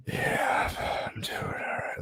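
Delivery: breathless, pained whisper; strained whisper. Retold or imitated. Telling roommate he's fine while barely breathing. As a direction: push the words out through chest pain. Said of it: "just trying to talk through it".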